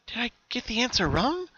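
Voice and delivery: high, anxious self-question; worried pony voice. Acted. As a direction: doubt creeping in at the door. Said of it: "she asked herself".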